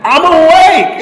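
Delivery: high, very loud holler; toddler's yell. Retold or imitated. Two-year-old son announces he's up, yelling. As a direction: belt it out high like a small child. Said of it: "he would yell this".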